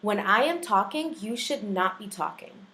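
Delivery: firm, emphatic classroom scolding; stern teacher, stressed word by word; assertive teacher voice. Acted. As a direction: lay down the law to the class. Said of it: "I learned to perfect my most assertive teacher voice".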